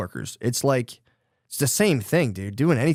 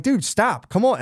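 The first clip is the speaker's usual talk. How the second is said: put-on high, exasperated scolding of dawdling kids; impatient, nasty parent; 10 semitones higher, 3 dB louder than usual. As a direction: act out a parent at the end of their patience. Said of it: "really impatient and really nasty".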